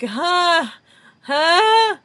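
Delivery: high, loud mock startled "huh"; awkward startled laugh. Imitating Phoebe dumbstruck by the request, loud awkward laugh. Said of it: "awkward, like, startled ... kinda laugh".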